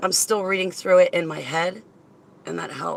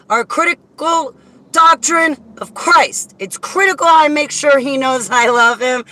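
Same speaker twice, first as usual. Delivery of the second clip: impassioned praise, voice raised high; fervent; 6 semitones higher, 6 dB louder than usual. Real, not acted. Thanking her chat room with fervor. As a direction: preach it out with fervor.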